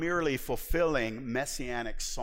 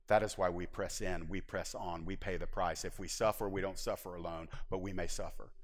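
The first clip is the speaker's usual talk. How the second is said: low exhortation; 9 semitones lower, 6 dB quieter than usual. Acted. Quiet, driving exhortation.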